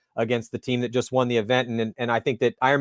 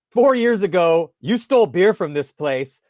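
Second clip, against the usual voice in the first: slow, deliberate, louder and higher at the start; stern accusation; 5 semitones higher, 4 dB louder, slower than usual. Retold or imitated. Bartender confronting him about the stolen beer, mock stern. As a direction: lay out the charge slowly like a scolding. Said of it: "and she goes".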